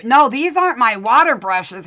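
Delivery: loud, insistent denial; emphatic protest. Real, not acted. Protesting a mix-up over brushes.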